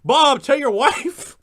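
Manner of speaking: loud, high, drawn-out call; hammed-up bellow to a fellow player; mock player hollering. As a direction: shout across the table at Bob. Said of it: "or even worse, even worse".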